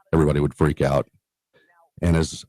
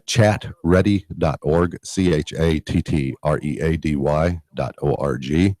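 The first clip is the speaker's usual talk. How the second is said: slower, spelled out. Shown as a demonstration. Speaker repeats the website on request for listeners who missed it, slower. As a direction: chat ready dot org, slowly and deliberately. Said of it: "say that again a little slower".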